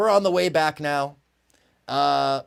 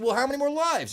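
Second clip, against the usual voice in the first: eager question; 11 semitones higher than usual. Retold or imitated. Drunk asks eagerly.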